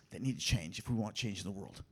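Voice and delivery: whispered, hushed and intimate; a preacher's hushed whisper; sudden whisper. Real, not acted. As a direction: drop to a whisper to drive it home. Emphatic point dropped to whisper.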